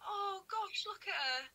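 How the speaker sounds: high adoring coo at Willow chasing a fly; cooing at dog. Real, not acted. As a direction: melt over a cute puppy.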